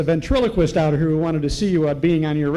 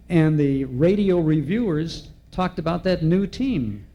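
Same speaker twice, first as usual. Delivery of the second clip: ordinary talk, nothing marked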